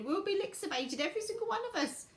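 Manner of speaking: high acted cry, climbing toward the end. A narrator's reading. Grandma Georgina cries they're doomed, pitch climbing. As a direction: wail it with rising alarm. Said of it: "cried Grandma Georgina".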